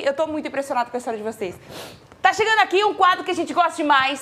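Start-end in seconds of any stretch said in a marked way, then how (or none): 2.2-4.2 show host announcement. Acted.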